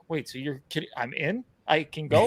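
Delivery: quiet, hushed disbelief; can't believe it's that easy. Retold or imitated. Traveler told he can go, hushed disbelief. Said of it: "And I'm like".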